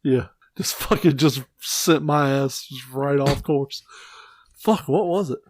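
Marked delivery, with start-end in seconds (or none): none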